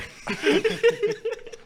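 real squealing laughter, high-pitched; high helpless laugh. Real, not acted. Cohost laughing at his own punchline, squealing laugh.

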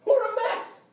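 high, loud shout of confession; excited cry. Retold or imitated. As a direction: cry out that you were wrong, loud and high. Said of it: "come back like".

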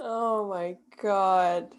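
drawn-out, slow oh my God; exasperated disbelief. Real, not acted. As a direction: stretch it out in mock despair. Reacting to the bike story.

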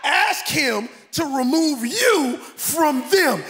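loud, drawn-out preaching shout; full-force preaching. Acted. Pastor rebuking the congregation, preaching shout. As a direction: preach it hard to the crowd.

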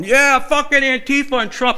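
high, loud hammed-up biker yell; screaming biker. A deaf biker shouting politics in the aisle, hammed up. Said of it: "random dude ... going".

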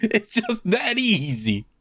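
high, slow, sing-song sarcasm; mock cheerful advice. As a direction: preach happiness sarcastically, drawn out. Mocking cheerful advice about misery.

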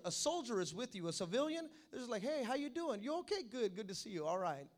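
normal talking voice

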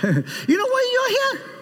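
high-pitched mock preacher cry; imitated preacher's challenge. Visiting preacher challenging a hundred students, high-pitched imitation. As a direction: put the question high, hammed up.

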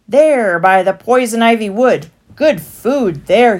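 sly stallholder. A narrator's reading. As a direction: sell it to them. Stallholder pitching places.

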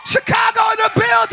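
high, sustained crowd-rousing shout; hype shout. Real, not acted. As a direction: shout out the city like a hype man. Shout-out to Chicago visitor.

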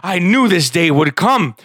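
high, loud and frantic; silly clown. Acted. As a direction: gush at the goose leader like a zealot. Mort worships the goose.

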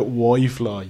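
"Wi-Fi" said as "Why-Fly"; "Wi-Fi" with a second syllable like "fly"; mispronounced Wi-Fi. Retold or imitated. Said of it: "she's been thinking Wi-Fi is Wi-Fi".

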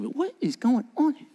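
high, baffled disciples asking what is happening; mock bewildered question, pitched high; puzzled disciples. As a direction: act out the confused disciples. Disciples voiced in retold scene.

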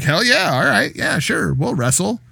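high, eager mock enthusiasm; eager agreement. Wrestler accepting a surprise match challenge, mock eager high voice. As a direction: jump at the challenge gleefully. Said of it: "Colt Cabana's like".

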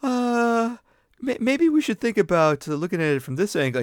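high, hesitant, overly polite suggestion; mock-tentative self. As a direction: imitate yourself gently suggesting another angle. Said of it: "Where I'm like".

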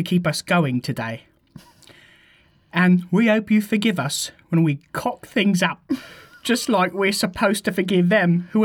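put-on Cockney prayer recital; Cockney accent. Reading the Lord's Prayer for a challenge, in Cockney. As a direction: pray chummily in your best Cockney accent. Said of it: "the Lord's Prayer written in Cockney".

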